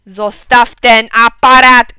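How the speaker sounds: very loud, slow order; angry shout. A narrator's reading. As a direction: bellow each word as a command. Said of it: "krzyknął tata". (bardzo głośny, powolny rozkaz)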